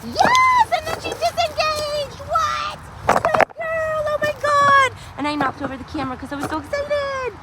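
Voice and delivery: very high, excited praise squeals; thrilled squeals. Real, not acted. Trainer celebrating her dog disengaging from a dog, thrilled high squeals. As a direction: let your voice fly up with delight.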